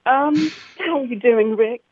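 playfully posh English accent; Downton Abbey posh. Shown as a demonstration. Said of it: "go more Downton Abbey".

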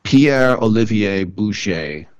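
slow, careful French Pierre Olivier Boucher; French pronunciation. Shown as a demonstration. Relishing a sophisticated French name, slow French pronunciation. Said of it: "flowery French names".

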